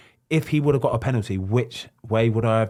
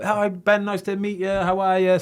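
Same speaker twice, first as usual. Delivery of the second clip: friendly greeting; 8 semitones higher than usual. Retold or imitated. Imitating Salah's greeting.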